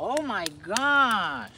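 drawn-out, thrilled praise; excited praise. Real, not acted. Praising dog louder.

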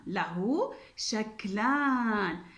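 slow repeat. Shown as a demonstration. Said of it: "إذن له شكلان".

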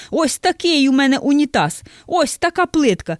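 loud and high, furious customer; shouting. Acted. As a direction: yell it at the counter. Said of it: "кричить". (кричить, розлючений покупець)

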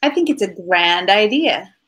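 normal talking voice

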